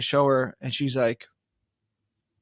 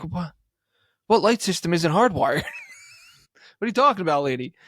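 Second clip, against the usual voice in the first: higher, louder, disbelieving; can't believe what she just said; incredulous outburst; 4 semitones higher, 4 dB louder than usual. Retold or imitated. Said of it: "I'm like".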